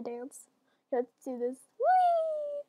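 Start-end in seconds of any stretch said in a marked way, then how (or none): 1.8-2.6 high, sustained squeal; long, high-pitched whee; playful whee. Real, not acted. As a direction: let out a gleeful squeal as you drop. Launching into the drop.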